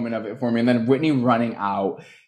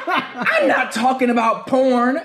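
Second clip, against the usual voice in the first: higher, slow, laughing line; laughing mock Mary; 10 semitones higher, much slower than usual. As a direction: squeal it through giggles. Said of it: "she's like".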